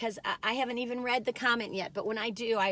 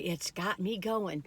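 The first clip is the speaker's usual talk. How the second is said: ordinary talk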